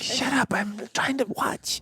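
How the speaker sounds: whispered, hushed and a bit slow; mock whisper. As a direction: hiss it at your friend in the cinema. Gaslighting friend at the movies.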